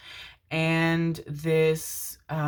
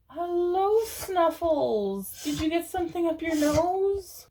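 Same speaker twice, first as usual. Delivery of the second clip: pet talk; 12 semitones higher, slower than usual. Real, not acted. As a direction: coo at your pet in a high voice. Talking to her pet dog.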